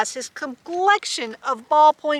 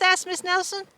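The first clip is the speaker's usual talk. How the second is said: ordinary delivery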